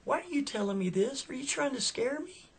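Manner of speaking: high, rising alarmed voice; frightened question. Retold or imitated. Woman to stranger in back seat, high and scared. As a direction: ask it with rising alarm, pitch climbing. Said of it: "she's like".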